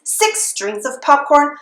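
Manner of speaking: ordinary delivery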